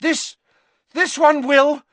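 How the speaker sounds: high, halting gasps; gasping Harry. Acted. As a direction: gasp it out, broken by pauses. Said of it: "gasped Harry".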